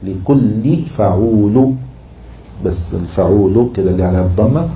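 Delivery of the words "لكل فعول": very slow, stretched syllables; metrical scansion. Shown as a demonstration. Teacher showing the class a foot, slow scansion. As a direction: draw out word and foot alike.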